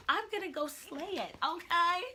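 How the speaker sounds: psyched, ready to win; hyped up. Real, not acted. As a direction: pitch jumps up, loud and pumped. Pumped up about graduating.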